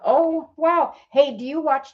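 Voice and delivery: high, eager retold gush; excited. Larry gushing to the stewardess, high and eager. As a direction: gush like a fan meeting a star.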